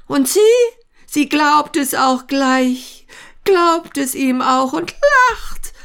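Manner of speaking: high, fretful agitation; worked up and flustered; agitated. Acted. Antinejew frets in inner monologue.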